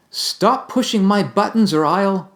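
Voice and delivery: high, slow, threatening; building to a threat; angry warning. Shown as a demonstration. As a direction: warn them off angrily. Acting out blaming quotes.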